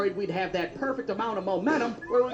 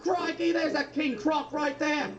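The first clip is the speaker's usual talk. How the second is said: higher, slow, hammed-up Australian accent; bad Australian accent; 7 semitones higher, slower than usual. Australian rider at the top of the hill in a hammed-up Australian accent. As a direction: overdo the Aussie croc-hunter accent. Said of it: "that Australian dude's like".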